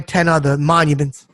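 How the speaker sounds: ordinary talk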